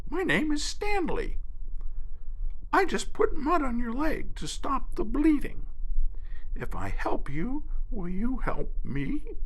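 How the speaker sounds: very high, light bird voice; spoonbill voice. Acted. Spoonbill introduces himself to the hurt alligator, birdlike. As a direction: chirp it like a small bird. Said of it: "The spoonbill said".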